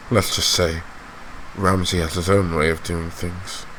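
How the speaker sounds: restrained voice sinking low and soft; quietly menacing lord. Acted. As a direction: leave the threat hanging between pauses.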